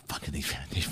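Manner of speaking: ordinary talk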